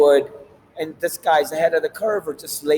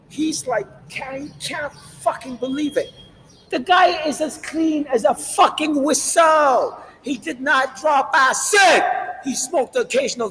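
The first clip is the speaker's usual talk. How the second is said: heated rant; 9 semitones higher than usual. Real, not acted. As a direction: hammer each word home, rising to a shout. Ranting defence of Manson.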